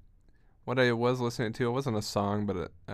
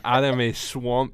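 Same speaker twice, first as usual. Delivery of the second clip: slow, loud Scottish echo; laughing Scottish mimicry; Scottish accent; 3 semitones higher, 7 dB louder, much slower than usual. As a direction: parrot it in a playful Scottish brogue. Echoing the Scottish rant, laughing.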